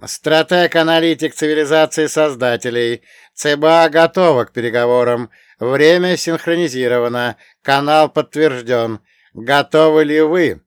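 even, booming metallic machine tone; metallic robot voice. Acted. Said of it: "ровный, почти металлический голос".

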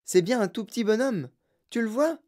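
put-on high, eager child's voice; excited cry. A narrator's reading. Charlie points out little man to grandpa, excited. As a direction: burst out, wanting grandpa to see. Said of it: "s'écria Charlie".

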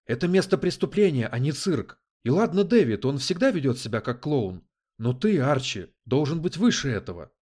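high, loud scolding; exasperated, forceful reprimand; seriously angry. A narrator's reading. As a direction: tell them off heatedly. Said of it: "не на шутку разозлился".

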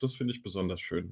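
normal talking voice

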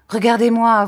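ordre lancé fort, plus aigu; ordre furieux. A narrator's reading. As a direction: exiger le regard avec rage. Rosa furieuse exige le regard. (ordre furieux)

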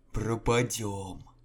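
ordinary delivery, nothing marked

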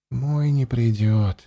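quiet, slow and bitter; heavy with bitterness. A narrator's reading. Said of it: "с горечью ответил Андема". (с горечью)

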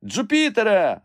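high, loud shout of a name. A narrator's reading. Master ignores his friend and calls his climber, shout. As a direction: shout up to someone far off. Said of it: "закричав Легран".

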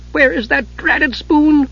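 high, slow, fussing little voice; high-pitched character grumbling over a search; piping exasperation. A narrator's reading. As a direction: draw out the complaint in a high voice. Tass frustrated, hunting for spoon.

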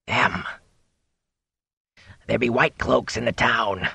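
nervous hoarse whisper. A narrator's reading. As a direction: keep it hushed and rasping. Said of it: "His voice dropped into a hoarse whisper".

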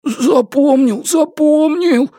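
high, slow, quavering submission; frightened babble. A narrator's reading. Threatened man yielding to his captor, high frightened babble. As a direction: stretch the words out high, cowed and helpless. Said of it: "пролепетал У Юньюй".

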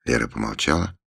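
ordinary delivery

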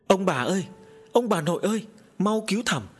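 high, loud cry for help; a boy shouting for his grandparents to save him; calling loudly. A narrator's reading. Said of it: "Nó gọi to".

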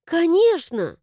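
eager, sincere exclamation; sincere assurance. A narrator's reading. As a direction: assure wholeheartedly. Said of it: "искренне заверила я".

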